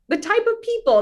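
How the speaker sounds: high, sing-song mock slogan; playful jab at a glossy recruitment pitch; mock promo voice. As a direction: toss it off like a cheesy ad tagline. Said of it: "that's not on their social media graphic, like".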